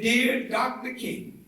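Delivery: usual speaking voice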